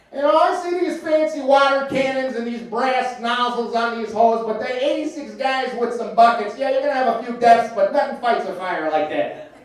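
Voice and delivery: hamming up a cranky uncle; Uncle Mike voice. Retold or imitated. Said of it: "This is that one".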